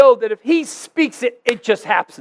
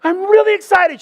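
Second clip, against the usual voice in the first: insistent excitement; 10 semitones higher, 4 dB louder than usual. Retold or imitated. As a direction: insist loudly that you really are thrilled. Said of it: "I think I'm, like, being really excited".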